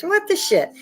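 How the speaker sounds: pitched high, spat out; exasperated exclamation; outraged disbelief. Real, not acted. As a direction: blurt it in shock.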